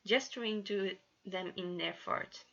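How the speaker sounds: ordinary delivery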